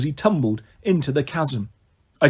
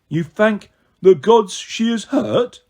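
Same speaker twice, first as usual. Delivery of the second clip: put-on slow, higher confused childlike voice; puzzled Tyson; 4 semitones higher, 4 dB louder, slower than usual. A narrator's reading. Tyson puzzled by Percy's greeting, slow. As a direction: puzzle over the words. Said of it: "he asked, puzzled".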